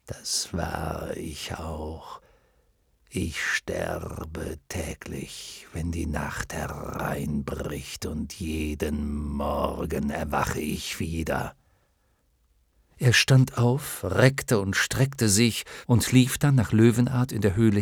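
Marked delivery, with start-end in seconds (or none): 0.1-11.5 slow, low whispered lion; whispering. Acted. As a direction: breathe it out slowly. Lion speaks of dying nightly.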